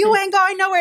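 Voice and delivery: high, insistent mock yell; retold screaming. Retelling hanging on the car door, screaming at David. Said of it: "I'm, like, screaming at him".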